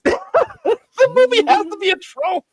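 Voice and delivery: high, slow, laughing through the words; can't keep a straight face; giggling it out slowly; laughing disbelief. Real, not acted.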